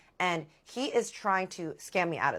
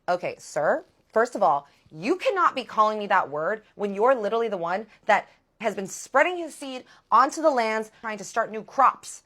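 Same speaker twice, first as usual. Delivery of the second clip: loud, higher-pitched retort; acted-out outraged scolding; indignant telling-off; 4 semitones higher, 8 dB louder than usual. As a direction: put him in his place, loudly.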